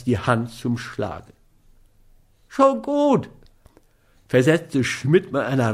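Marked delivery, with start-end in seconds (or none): 2.5-3.3 very high, alarmed giving in; frightened, hasty. A narrator's reading. Schmidt yields as Scott raises his hand, frightened. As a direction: give in at once in a high, scared voice. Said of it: "die nur aus der Furcht entspringen konnte".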